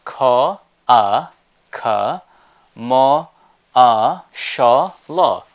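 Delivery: commercial broken into separate syllables. Shown as a demonstration. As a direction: spell out "Ka, a, ka, mo, a, sha, la" slowly, one beat each. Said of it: "And what is that?".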